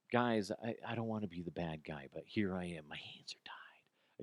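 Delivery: fading into a soft helpless whisper; mock reluctant boss. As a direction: play the boss who pretends he's powerless, trailing to a whisper. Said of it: "You're coming off like".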